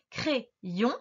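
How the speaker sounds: CRAYON split cray - yon; syllable breakdown. Shown as a demonstration. Teacher explains the Y, CRAYON in two syllables. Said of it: "une première syllabe qui fait cray".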